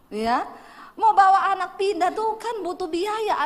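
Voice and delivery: preacher laughing about having no money to move. Real, not acted. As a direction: laugh while explaining the cost. Laughing at her own poverty.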